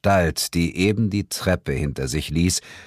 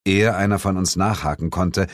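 ordinary delivery

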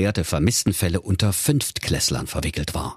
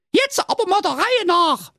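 put-on very high, squeaky girl voice; cheeky young girl. Police girl starts interrogation.

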